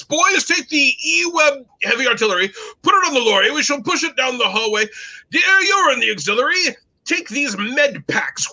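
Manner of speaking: high, commanding mock British officer accent; terrible British accent. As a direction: lecture the troops in plummy put-on British. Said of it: "I will do my terrible British accent".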